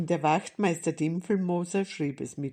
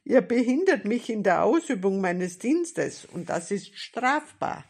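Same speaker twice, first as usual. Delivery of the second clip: indignant official rebuke, raised pitch; scolding; 4 semitones higher, 6 dB louder than usual. A narrator's reading. Policeman telling boys they obstruct his duty, scolding. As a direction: lay down the law crossly. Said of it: "schimpfte er".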